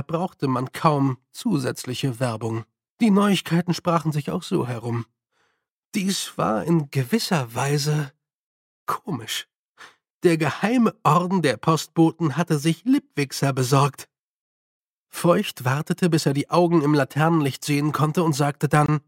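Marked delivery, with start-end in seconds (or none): none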